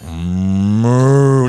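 loud, long mooing call; toy cow moo. Retold or imitated. Teller imitating the cow can in the meeting, loud moo. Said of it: "I go".

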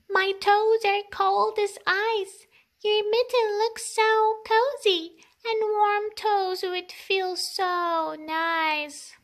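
high, pleading little mouse; tiny mouse voice. Acted. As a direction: beg sweetly like a tiny mouse.

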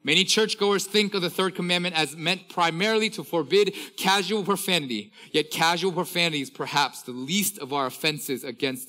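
preacher reading a professor's quote with fervour; preaching quote reading. Acted. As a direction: press each phrase home to the congregation.

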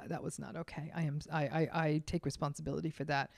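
a soft, quiet, sincere apology. Shown as a demonstration. Owning up to a coworker after snapping, soft and sincere. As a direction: own the mistake gently and softly. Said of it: "very quickly say".

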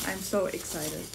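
ordinary talk

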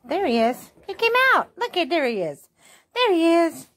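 high, loud pet voice; sing-song greeting to a freshly bathed dog. Real, not acted. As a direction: coo excitedly at the dog. Owner baby-talking to dog.

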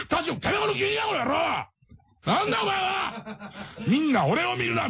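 high, laughing mock yell; furious shout. Beluga yelling at comedian Tashiro, laughing mock yell. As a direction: scream it like a raging thug. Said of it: "みたいな感じになっちゃうんですよ".

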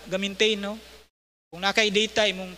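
ordinary talk

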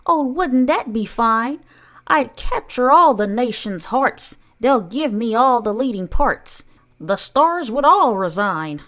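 high, loud, swooping daydream of stardom; rapturous Aunt Sponge. A narrator's reading. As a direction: gush grandly in a high aunt voice. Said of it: "Aunt Sponge declared".

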